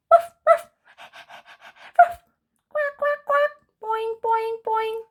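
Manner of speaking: high barks, quacks and boings; animal noises. Acted. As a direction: play all the animals at once. Animals playing together at end.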